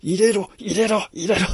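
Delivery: high, frantic shouting; frantic demanding yell. Retold or imitated. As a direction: pound the door and demand to be let in. Woman demanding entry, banging head.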